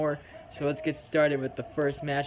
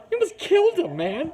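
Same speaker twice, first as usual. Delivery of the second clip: high shocked exclamation; 6 dB louder than usual. Real, not acted. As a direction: blurt it out in disbelief. Shock at a wrestling move.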